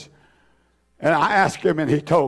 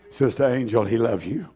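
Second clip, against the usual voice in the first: normal talking voice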